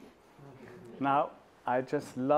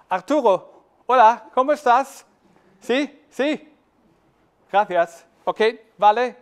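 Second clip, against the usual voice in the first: loud, higher Spanish greeting across a party; calling to a friend; 7 semitones higher, 10 dB louder than usual. Pretend. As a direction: hail your friend over the crowd, cheerfully. Acted greeting across a noisy party.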